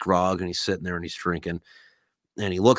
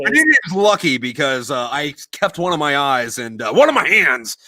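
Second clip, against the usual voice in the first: hammed-up joke voice; 5 semitones higher, 7 dB louder than usual. Joke voice as a maimed worker.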